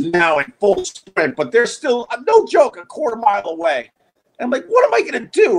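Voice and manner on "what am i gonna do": high-pitched, exasperated question; raised, alarmed voice of someone stuck; panicked helplessness. Acted. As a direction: act out being at a loss. Helpless as the dogs fight.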